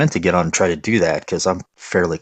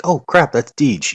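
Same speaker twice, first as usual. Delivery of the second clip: higher-pitched, drawn-out mock alarm; slow, pitched-up quoted dismay; imitated startled recognition; 8 semitones higher, slower than usual. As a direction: act out an oh-no double take. Voices players spotting known names.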